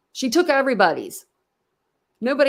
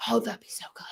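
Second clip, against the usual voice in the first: whisper; 8 dB quieter than usual. Real, not acted. Excited whisper about calling in.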